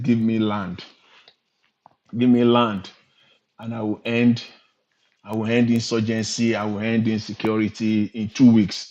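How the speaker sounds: loud declaiming, like a stump speech; mock rally shout. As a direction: belt the promise out like a campaign rally. Quoting a politician's campaign promise.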